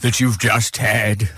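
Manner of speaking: low, solemn wartime-orator impression; ponderous old statesman reminiscing; Winston Churchill. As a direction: address the monarch in a mock-grand statesman's voice. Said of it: "That's, uh, Churchill".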